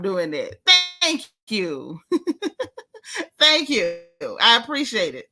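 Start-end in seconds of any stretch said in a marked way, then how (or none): 2.1-3.3 high laugh. Real, not acted. Laugh after being thanked.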